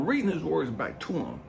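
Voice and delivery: normal talking voice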